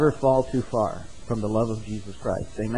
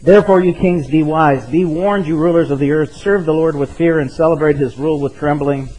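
loud, raised reading of a psalm warning kings; scripture reading; 5 dB louder than usual. Acted. Pastor reads the psalm's warning to the congregation, loud and proclaiming.

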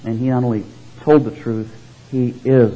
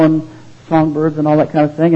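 usual speaking voice

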